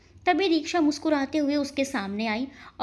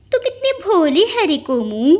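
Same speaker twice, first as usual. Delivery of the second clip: taunting; 4 semitones higher, 7 dB louder than usual. Acted. Taunting after a laugh.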